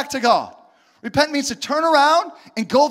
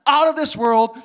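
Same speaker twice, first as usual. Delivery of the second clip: ordinary delivery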